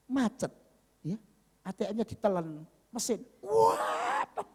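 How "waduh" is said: very high, mock panicked wail; bewildered wail. Man whose card the ATM swallowed, mock panicked wail. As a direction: wail in exaggerated dismay, voice shooting up.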